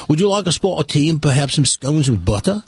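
put-on everyday English accent; imitation English accent. Host practises the tea line for guest, imitation English accent. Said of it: "just every English accent".

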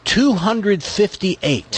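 slow, each word weighed, high and emphatic; slow dramatic reveal. Real, not acted. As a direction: land the number like a bombshell.

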